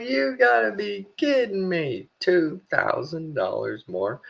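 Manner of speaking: high, slow, drawn-out disbelief at the bill; stunned disbelief. Retold or imitated. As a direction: let the outrage stretch out each word. Retelling shock at the bill.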